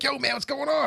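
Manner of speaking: high-pitched, overwhelming party-guy greeting; Ryan. Retold or imitated. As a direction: imitate a life-of-the-party buddy bursting with energy. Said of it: "overwhelming energy".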